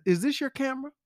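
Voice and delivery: high-pitched mock mama questioning; lightly put-on mama voice, pitched high; mama mode. April to her friend after retrieving the camera, mock mama mode. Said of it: "in full mama mode".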